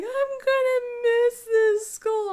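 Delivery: high, slow mock wail; loud, drawn-out whiny cry. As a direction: imitate a sobbing child wailing to mom. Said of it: "she's just crying".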